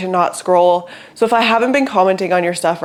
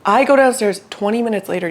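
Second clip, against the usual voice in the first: usual speaking voice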